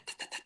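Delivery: soft voiceless percussive ticking; imitated beat ticks. Songwriter demonstrating the backing beat, in soft mouth clicks. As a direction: tick out a beat under the breath.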